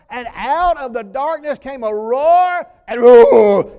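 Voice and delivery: slow build into a loud roar; dramatic roar. Pretend. Preacher as David recounting the lion attack, roaring. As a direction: roar it out of the darkness.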